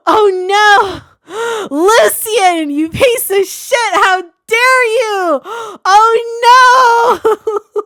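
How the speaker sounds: high, loud cries of outrage; outraged yelling. Real, not acted. Reader furious at Lucian on screen, shouting high and loud. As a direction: scream at the villain through the screen. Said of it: "Let me stop yelling into your eardrums".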